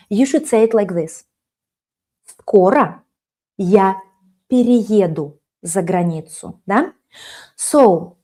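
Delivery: ordinary delivery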